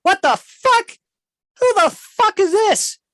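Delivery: high, loud startled creature shriek; squawking outraged dragon; angry dragon. Acted. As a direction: screech at the intruders like a cornered beast. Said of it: "He goes".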